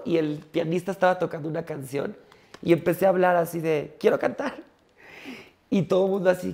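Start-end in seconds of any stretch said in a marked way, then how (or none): 4.0-4.5 put-on high small-child voice; high little voice saying quiero cantar; childish request. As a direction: ask like a tiny girl at the mic. Child on stage asking.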